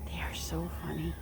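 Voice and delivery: soft amused whisper, a bit quick; hushed aside about the birds. Real, not acted. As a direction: whisper it so the birds stay calm. Talking quietly beside the turkeys.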